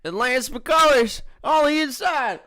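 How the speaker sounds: very high, loud mock race call; NASCAR race commentator. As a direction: yell it like live stock-car commentary. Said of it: "could have been a great nascar driver".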